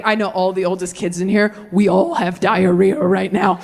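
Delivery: ordinary delivery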